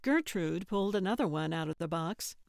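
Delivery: usual speaking voice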